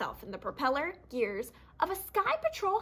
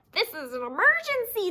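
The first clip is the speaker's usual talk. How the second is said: a higher, louder official declaration; mock official; 8 semitones higher, 5 dB louder than usual. Fox as officer to birds, mock official declaration. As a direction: announce it with fake authority. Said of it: "declared the fox".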